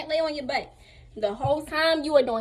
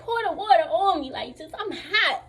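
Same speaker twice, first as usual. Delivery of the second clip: higher, exasperated mock demand; retold irritated insistence; 5 semitones higher than usual. Overheated patient demanding water poured on her, irritated. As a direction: act out a hot, impatient patient.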